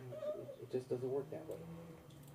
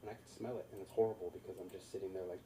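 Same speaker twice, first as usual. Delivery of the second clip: ordinary talk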